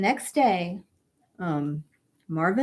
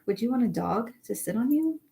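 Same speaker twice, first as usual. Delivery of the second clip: ordinary delivery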